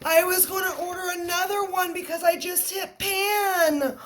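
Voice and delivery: high teary excitement; overjoyed, near tears. Real, not acted. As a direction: squeal it through happy tears. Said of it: "I'm legit about to cry".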